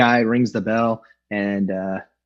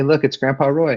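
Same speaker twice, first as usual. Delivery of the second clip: ordinary talk, nothing marked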